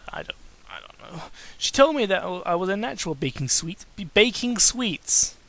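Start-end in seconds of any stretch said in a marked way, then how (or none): 0.1-1.2 low unvoiced mumble; a puzzled whisper to himself. Real, not acted. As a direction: trail off, baffled, under your breath. Muttered confusion.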